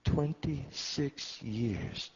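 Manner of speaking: very slow, each word stretched; drawn out. Retold or imitated. Old grandfather speaking to his grandson, drawn out. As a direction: let the years weigh on it. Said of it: "he said".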